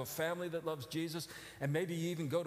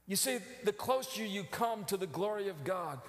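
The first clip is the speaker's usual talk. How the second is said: normal talking voice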